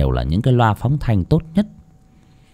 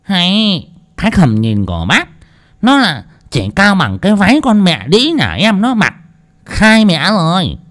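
scornful; 9 semitones higher than usual. A narrator's reading. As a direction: pout and sneer at him. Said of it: "đoạn bĩu môi".

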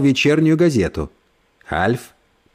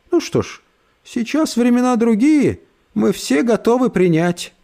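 sugary condescending lady; 9 semitones higher than usual. Acted. Брит Мари снисходит.

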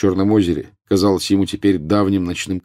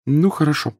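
higher-pitched woman professor; a hint of a higher professor's voice; lightly voiced professor; 10 semitones higher than usual. A narrator's reading. Professor's voice in story dialogue.

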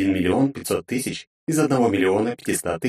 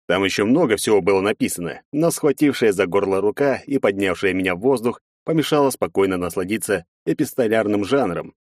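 plain reading voice

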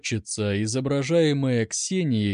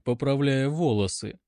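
ordinary delivery, nothing marked

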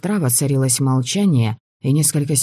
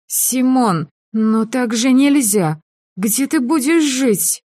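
чуть не плача; 10 semitones higher, slower than usual. A narrator's reading. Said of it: "словно она вот-вот расплачется".

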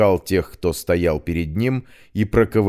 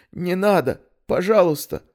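pleading; 11 semitones higher than usual. Acted. Раненый Кеша умоляет.

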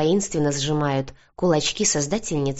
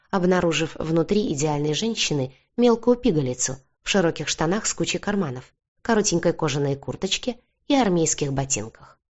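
plain reading voice

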